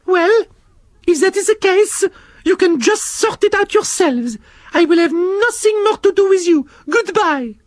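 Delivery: very high, prim scolding snail voice; Shelley the snail scolding the worm and slug, very high prim voice; French snail Shelley. Acted. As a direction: tell off two squabbling children. Shelley gives up.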